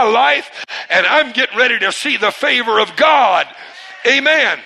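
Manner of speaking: loud, rising declaration of faith; full-force preaching. Real, not acted. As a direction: build to a triumphant shouted "Amen".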